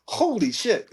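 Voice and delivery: summing up the amazing day of boa finds; amazed holy shit. Retold or imitated. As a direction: say it like you still can't believe it. Recalling the whole day.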